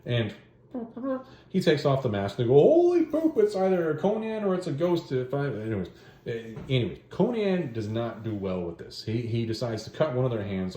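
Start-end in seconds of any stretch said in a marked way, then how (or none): 2.5-5.1 much higher, louder startled yelp; shocked cry. Acted. Guards seeing the executioner is Conan, crying out in shock. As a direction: jump out of your skin at the reveal.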